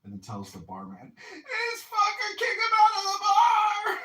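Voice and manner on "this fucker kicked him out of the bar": put-on shrieking high drunk wail, slow; hammed-up hysterical yell; mock crying scream. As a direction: screech it like a sobbing drunk. Drunk woman screaming at barman.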